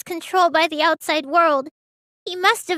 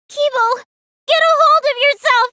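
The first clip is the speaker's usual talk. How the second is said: higher, louder and fast, trying to snap him out of it; urgent call; 6 semitones higher, 4 dB louder than usual. Acted. Friends calling out to a frozen classmate, urgent and loud. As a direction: call him back to his senses, quickly. Friends shaking unresponsive robot.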